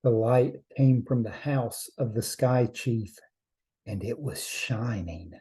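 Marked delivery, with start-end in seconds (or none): none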